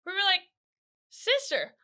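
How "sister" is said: higher, worried call to the sister; mock panic at a strange sister; alarmed family.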